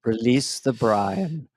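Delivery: very slow, calm, soothing presidential voice; President Brian. Acted. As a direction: lull them with drawn-out, low-key calm. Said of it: "This is my impression of our next president".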